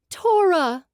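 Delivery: high, loud cry of a name; calling out. Acted. Another woman calls to the fallen guardian, a high loud cry. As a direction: cry her name across the room in alarm.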